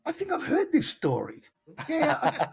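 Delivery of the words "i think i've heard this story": much higher, put-on voice of someone who's heard it all; a mock inner groan at a story told twice; weary mock thought. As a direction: roll your eyes through the words, mocking. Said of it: "I'm looking at him going".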